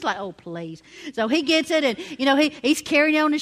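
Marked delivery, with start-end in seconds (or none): 0.2-0.8 low, soft, unimpressed retort; dismissive reply. Retold or imitated. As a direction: brush off the eager plea under your voice. Retelling companion's dismissive reply to plea.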